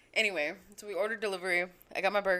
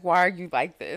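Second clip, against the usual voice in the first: louder, exasperated mom; mock exasperated mom; 2 semitones lower, 8 dB louder than usual. Mom scolding her daughter, louder exasperated. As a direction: sigh it out as a fed-up mom. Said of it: "She's like".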